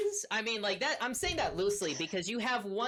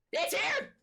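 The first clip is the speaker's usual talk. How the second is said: high frantic cry of terror; panicked shriek; 4 dB louder than usual. Acted. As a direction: shriek it like a cornered gnome. First take, shrill panic.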